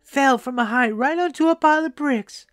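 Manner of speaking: put-on high-pitched, distressed old lady; lamenting grandmother. A narrator's reading. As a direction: pour out your grandson's misfortune, high-voiced. Old woman laments grandson's accident.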